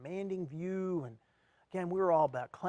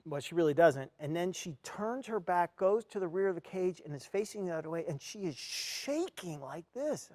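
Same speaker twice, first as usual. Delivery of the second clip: normal talking voice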